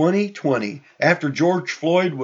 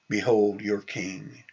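soft, low, slow statement; hushed, unhurried presentation of someone; quiet announcement; 5 semitones lower, 6 dB quieter than usual. Retold or imitated. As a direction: say it quietly and slowly, understated. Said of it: "he might have said it".

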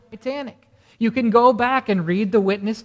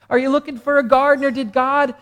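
louder, higher, building preaching; fervent preaching; 4 semitones higher, 5 dB louder than usual. Acted. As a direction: rouse the congregation with rising fervour.

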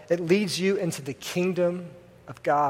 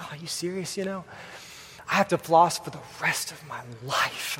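mock dramatic moping; 8 dB quieter than usual. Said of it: "I was being dramatic".

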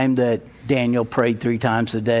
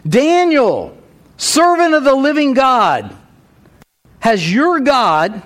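high, loud anguished cry of a desperate king; anguished call; 9 semitones higher, 5 dB louder than usual. Acted. King calling to Daniel in the lions' den, anguished and loud. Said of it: "called out to Daniel in an anguished voice".

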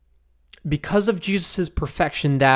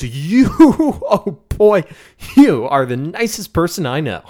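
mock pissed-off; 4 dB louder than usual. Someone in a fight landing a compliment, mock-angry. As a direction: pretend you're fuming, stretching out the words. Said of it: "they're pissed off at each other".

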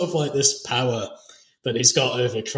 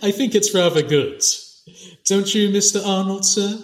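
light mock politeness, higher; 7 semitones higher than usual. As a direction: tease him with fake courtesy. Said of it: "says it with this mocking tone".

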